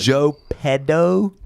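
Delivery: stretched slow pun; punchline drawl. Acted. Joking about the Pinocchio name, slow and stretched. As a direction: drag out the joke name. Punning on Geppetto.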